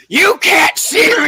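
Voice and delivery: high, slow mock yell of the aunt; a hammed-up shriek at her daughter. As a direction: shout it up high like the frustrated aunt. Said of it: "you hear her go".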